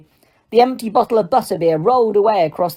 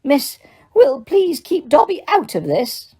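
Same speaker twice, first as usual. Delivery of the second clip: raised, slow elf voice; mumbling Dobby; 7 semitones higher than usual. A narrator's reading. Dobby begs Hermione to leave him out. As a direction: mumble it nervously. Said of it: "Dobby mumbled".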